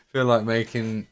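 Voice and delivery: usual speaking voice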